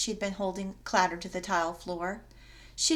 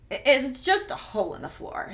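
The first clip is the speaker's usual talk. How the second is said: quivering voice; 5 semitones higher, 6 dB louder than usual. Acted. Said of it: "but her voice quivered".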